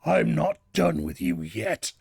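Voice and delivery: low, slow villain taunt; a hint of smug boss menace; menacing god. Boss taunting the beaten player, lightly voiced low menace. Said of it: "And then he's like".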